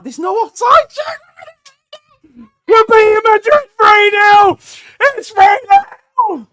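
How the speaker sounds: very high, full-volume celebration; ecstatic screaming. Real, not acted. Fan celebrating three nil against Madrid, ecstatic screaming. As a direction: scream it out, stretching the score.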